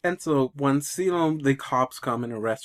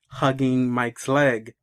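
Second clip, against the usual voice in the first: usual speaking voice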